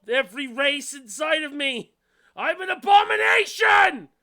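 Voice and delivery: rising to a frantic shout; hammy character voice. Acted. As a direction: build from upset into a screaming rage. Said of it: "she's just like".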